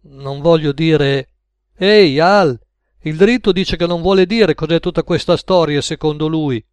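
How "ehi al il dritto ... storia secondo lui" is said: loud hail, then raised relaying voice; calling through. Acted. Max reporting on George to Al in the kitchen, calling out. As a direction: hail your partner, then report so it carries.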